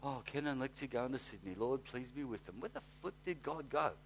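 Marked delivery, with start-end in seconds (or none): 0.1-2.6 mock limp prayer. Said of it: "I hate that prayer".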